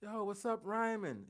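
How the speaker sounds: calling out like a pushy guy from New York; New York dude. Retold or imitated. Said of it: "he's like".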